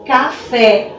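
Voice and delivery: café with the last syllable, fé, stressed; stress on final syllable. Shown as a demonstration. Teacher shows pupils where café is strongest, stressed last syllable. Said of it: "a sílaba mais forte é a última".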